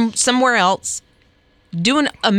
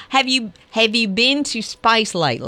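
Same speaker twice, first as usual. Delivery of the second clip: "lately" said as LIGHT-lee, Australian style; coach's Australian accent, "lately" rhyming with "nightly"; Australian accent demo. Shown as a demonstration. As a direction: show the Australian vowel on "lately". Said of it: "you lose it on the lately".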